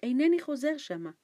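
loud, high defiant refusal; firm refusal. A narrator's reading. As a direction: refuse outright. Boy insists again.

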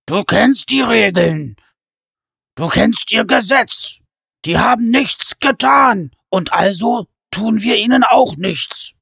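high, loud, slow giant warning; a giant laying down the law; stern giant. A narrator's reading. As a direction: giant reminding his brother of the rules. Giant Pen lectures his brother.

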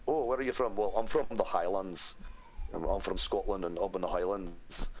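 Scottish Highlands accent with pronounced R. Shown as a demonstration. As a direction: chat like a Highlander saying where home is. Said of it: "more of a higher Highland squat".

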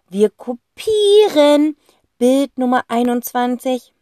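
high, loud, slow announcement; determined boy voice. Acted. Boy announcing which painting they copy, slow and loud. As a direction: declare the plan slowly and firmly. Zack figure voice.

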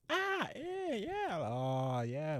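chatty Uber driver talking your ear off; chatty driver impression. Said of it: "And then".

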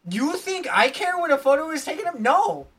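high-pitched mock-outraged retort; indignant denial. Real, not acted. Denying photo obsession to friend, indignant. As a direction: scoff at the accusation, high.

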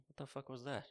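usual speaking voice

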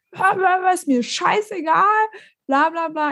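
high, slowed mimicry of a ranting man; mock angry yell. Neighbour dismissing the dog walker's excuse, mock ranting. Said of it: "schrie Julian an".

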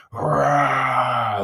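loud victorious roar; triumphant roar. Retold or imitated. Holding the fish up for his buddies, loud triumphant roar. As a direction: roar out a caveman win.

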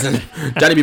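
ordinary talk, nothing marked

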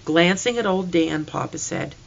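usual speaking voice

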